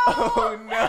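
loud laughing oh no; embarrassed shout. Retold or imitated. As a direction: cry out in embarrassment. Retold embarrassed exclamation.

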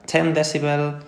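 "ten decibel" with no s on the end; dropped final S. Shown as a demonstration. Teacher showing students the typical error, "decibel" without its final s. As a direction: say "ten decibel", swallowing the plural s. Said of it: "porque me cuesta decir esa S".

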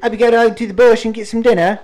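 high, loud mock Aussie voice; bad Australian accent, loud and high; terrible Australian accent. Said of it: "it's my terrible Australian accent".

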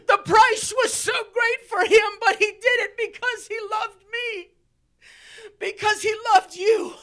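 shouted, high preaching with a crying edge; full-force preaching. Real, not acted. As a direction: preach it out with all your strength.

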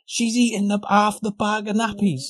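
lightly high retold wife; a touch of squeaky panic; wife reporting the dog's mess, mildly high; mock wife voice. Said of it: "wife explains on phone".

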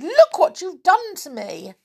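high-pitched indignant wail; distressed cry. A narrator's reading. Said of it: "she cried".

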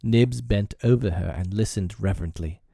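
plain reading voice

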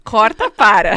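ordem alta e firme de parar; corta. Real, not acted. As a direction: corta a fala com força. Interrompe a própria história. (interrupção alta e decidida)

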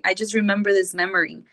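ordinary talk, nothing marked

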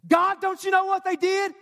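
high-pitched outcry to God; indignant protest. Acted. Wronged believer challenges God about offenders, high and indignant. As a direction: burst out in acted protest, pitch jumping up.

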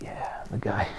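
a soft, secretive whisper; whispered reply. Retold or imitated. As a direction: breathe it like a mafia confirmation. His retold reply to churchgoers.

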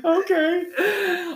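very high, squeaky mock "okay"; loud falsetto teasing imitation; mimicked meek agreement. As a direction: laughingly parody a squeaked yes.

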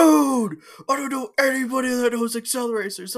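high, loud mock-ecstatic shout; excited yell. Best friend thrilled to find another fan, excited yell. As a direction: burst out, voice shooting way up high.

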